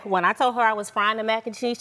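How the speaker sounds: ordinary talk, nothing marked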